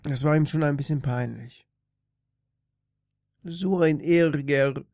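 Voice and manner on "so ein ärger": slow fake Russian accent; lightly put-on Russian accent; russian accent german. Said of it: "komischen, äh, pseudorussischen Akzent".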